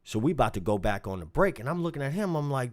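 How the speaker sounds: normal talking voice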